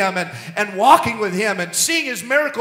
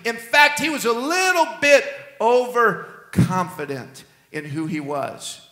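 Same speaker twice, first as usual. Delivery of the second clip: usual speaking voice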